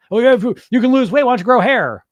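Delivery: mock the troll's jeer, fast and loud; hammed-up snide commenter.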